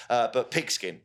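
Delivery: ordinary talk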